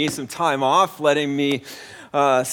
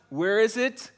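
ordinary delivery

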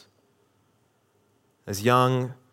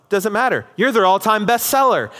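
high, louder mock comeback; imitated gleeful retort; 7 semitones higher, 4 dB louder than usual. Coworker topping George's jerk store line, acted one-upping. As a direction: top the insult, pitched well up.

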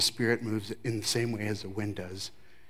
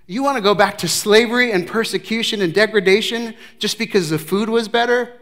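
incredulous; 8 semitones higher, 9 dB louder than usual. Acted. Incredulous at Israel's complaint.

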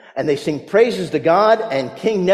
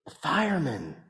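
put-on very soft, small child's voice; little boy; 3 semitones lower, 12 dB quieter than usual. A four-year-old answers the pastor, very soft.

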